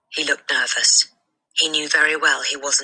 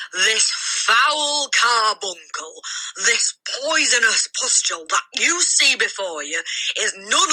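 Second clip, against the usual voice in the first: scornful headmistress; 6 semitones higher than usual. A narrator's reading. The headmistress denounces the boy before the school. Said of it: "boomed the headmistress".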